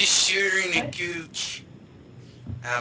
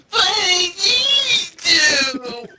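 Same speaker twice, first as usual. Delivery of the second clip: slow, higher crying whine; whiny kid; 12 semitones higher, 3 dB louder, much slower than usual. Acted. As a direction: whine it like a spoiled child. Whiny kid bit again.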